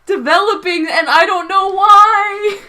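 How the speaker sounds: high, rising wail of protest; exasperated whine. Real, not acted. Co-host protesting the fake voice, exasperated whine. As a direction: plead loudly, half laughing, half wailing.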